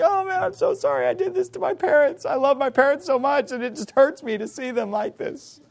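put-on high-pitched tearful child apologizing; put-on weepy remorse, high all through; mock crying. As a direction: imitate a sobbing kid's confession. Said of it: "If they were crying and saying".

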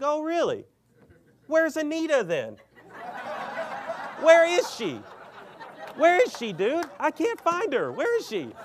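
high, loud mocking needling; taunting challenge. Preacher taunting the man about his pastor's wife, mocking. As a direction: rub it in with a gleeful laugh. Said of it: "And I'm like".

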